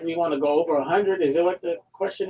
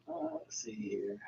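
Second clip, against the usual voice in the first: whispering; 8 semitones lower, 17 dB quieter, slower than usual. Real, not acted. Quietly looking for the poll.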